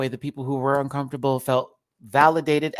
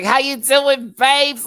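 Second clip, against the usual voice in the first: high, loud mock Australian accent; goofy Australian call-out, pitched way up; silly Australian greeting; 14 semitones higher, 11 dB louder, slower than usual. Retelling greeting the Babs on sight, silly Australian accent. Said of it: "very stupid, but I just, I couldn't help myself".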